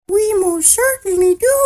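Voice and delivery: very high tiny squeak; piping voice. A narrator's reading. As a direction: pipe it up like a tiny creature. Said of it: "piped the voice".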